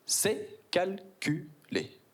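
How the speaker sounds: very slow, deliberate; smug slow reveal; drawn-out. Acted. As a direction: draw it out smugly.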